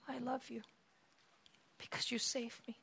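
hushed, near-whispered devotion; very soft, pouring the heart out quietly; tender confession. Acted. Forgiven woman telling her Savior she loves him, hushed and tender.

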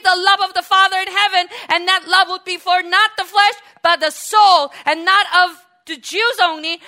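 loud, high preaching at full force; a soaring, shouted sermon climb; fiery preaching. Real, not acted. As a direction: preach it out to the whole hall.

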